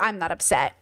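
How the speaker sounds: low, dropped-pitch denial of feeling; stoic denial. Retold or imitated. Imitating younger self watching animals die, stoic denial. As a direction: act out a lightly toughened younger self. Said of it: "when I was younger, I'd be like".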